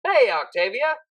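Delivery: repeated greeting. A narrator's reading. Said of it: "he repeated, this time not adding".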